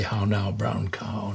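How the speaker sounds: soft, slow attempt at correct English accent. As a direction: carefully round the vowels in an English accent. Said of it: "lose my Australian accent and say".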